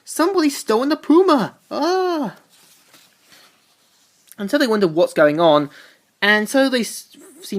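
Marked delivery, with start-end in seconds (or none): 0.0-2.4 much higher, alarmed cry of shock; shocked exclamation. Retold or imitated. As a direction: react as if the prize just vanished. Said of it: "they all gasp in shock".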